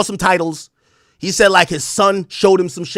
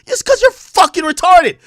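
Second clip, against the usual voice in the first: much higher, louder incredulous punch; exasperated; 12 semitones higher, 5 dB louder than usual. Retold or imitated. Host's intrusive thought about the rapper, exasperated. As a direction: explode with disbelief.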